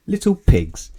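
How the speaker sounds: a loud sorcerer's threat; wizard's threat. Acted. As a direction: boom out the punishment.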